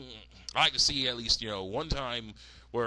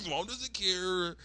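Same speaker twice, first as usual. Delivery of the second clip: drawn-out, higher mock lament; mock dejected whine; 6 semitones higher, much slower than usual. Voicing a daughter giving up on her mom, dejected whine. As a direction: stretch it out in a put-on whine.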